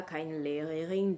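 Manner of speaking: usual speaking voice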